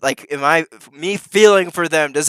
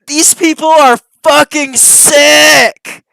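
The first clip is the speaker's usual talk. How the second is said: slow, loud, much higher outraged yell; outraged shout; 11 semitones higher, 10 dB louder, much slower than usual. Real, not acted. Streamer yelling at the clip's speakers, slow and loud. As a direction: bellow it out at them in disgust.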